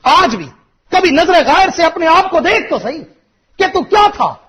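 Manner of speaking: loud, high, impassioned sermon voice; full-force preacher's shout; fiery preaching. Acted. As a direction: preach it out, building to a cry.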